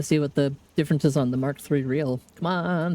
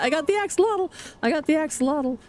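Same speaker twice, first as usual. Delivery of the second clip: much higher, bursting with joy; announcing to viewers she caught the axolotl, thrilled; 9 semitones higher, faster than usual. Real, not acted. High thrilled cry.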